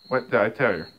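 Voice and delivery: normal talking voice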